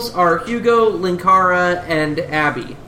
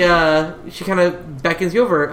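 normal talking voice

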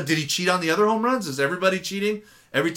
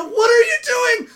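much higher, slow, disbelieving cry; a pundit's slow, high disbelief at a host's call; exasperated outburst; 4 dB louder than usual. Real, not acted. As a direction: demand it in outraged disbelief. Outraged at radio host's take.

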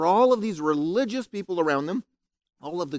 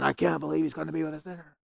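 grumbling crowd; 3 semitones lower, 8 dB quieter, faster than usual. Retold or imitated. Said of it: "crowd grumbling and complaining".

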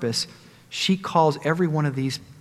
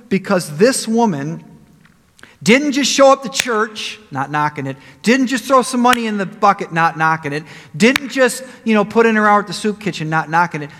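rising passion; 6 semitones higher, 6 dB louder than usual. Real, not acted. Preacher building his point.

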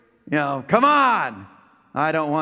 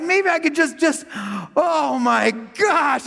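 drawn-out, higher amazed gasp at the view; awed gasp; 7 semitones higher, slower than usual. Retold or imitated. Riding past the lake, he gasps in awe. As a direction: let the wonder slow the words right down.

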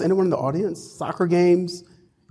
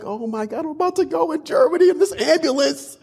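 high, worked-up storytelling panic; retold mild panic; 12 semitones higher than usual. Traveler recounting being swept into a German ambulance, mock panic. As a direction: play up the dawning alarm, pitched high. Retelling own panic at the time.

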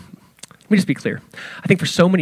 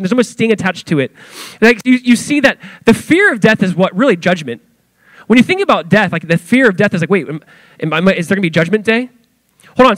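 fired-up preaching; 5 semitones higher than usual. Acted. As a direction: press the point home to the church. Preaching at raised intensity.

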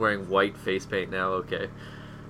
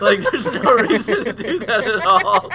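laughing; 10 semitones higher, 6 dB louder than usual. Real, not acted. Laughing at the story.

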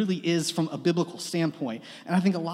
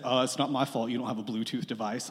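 a lower, softer, dismissive voice for Austin; friend's retort; 7 semitones lower, 6 dB quieter than usual. Retold or imitated. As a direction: brush it off the way Austin did. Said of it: "he said".

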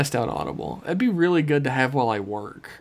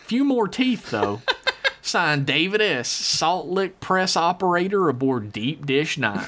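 country drawl, chatty and folksy; folksy hillbilly letter-writer; 3 semitones higher than usual. Acted. Host reading out a listener's question, in a country drawl. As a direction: read it as a rambling backwoods yokel. Reads listener email in character.

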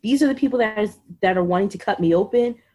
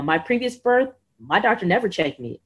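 ordinary delivery

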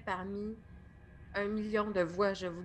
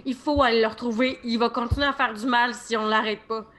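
loud, pressing plea; urgent insistence; 3 semitones higher, 8 dB louder than usual. Acted. Barbara urging the men to track him down. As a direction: push hard, voice raised in alarm.

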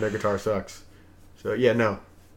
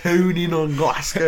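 higher, slow, hammed Scottish accent; attempted Scottish accent; 6 semitones higher, 4 dB louder, slower than usual. Shown as a demonstration. Practising a phrase for friends, attempted Scottish accent. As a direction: stretch the phrase out in amateur Scottish. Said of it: "Scottish is the one I've tried".

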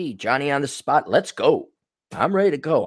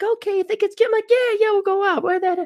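mock high voices; faster than usual. Voicing both, high.

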